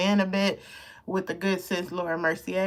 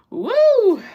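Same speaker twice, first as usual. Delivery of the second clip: whoop; 11 dB louder than usual. Real, not acted. Exclaiming, overwhelmed.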